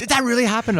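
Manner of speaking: very high, loud Australian tourist disbelief; mock Australian astonishment. Australian tourist gaping at the rider's landing, astonished Australian accent. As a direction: squeal it as a hammed-up Australian onlooker. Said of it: "a couple Australian tourists standing there".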